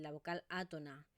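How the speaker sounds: usual speaking voice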